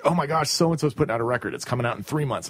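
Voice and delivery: quick giddy anticipation, climbing at the end; acted fan excitement. Fan awaiting a new record, giddy excitement. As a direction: play an eager fan counting down.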